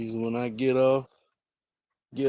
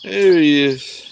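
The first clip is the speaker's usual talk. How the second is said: higher and much louder, delighted; excited greeting; 4 semitones higher, 11 dB louder, slower than usual. Real, not acted. Spotting the cat arriving, an excited loud greeting. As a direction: greet the pet happily and loudly.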